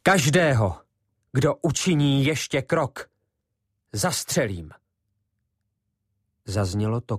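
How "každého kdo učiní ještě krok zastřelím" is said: a steady threat with a gun aimed; calm, convincing warning shouted to the boys; full-voiced. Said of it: "vykřikl plným hlasem".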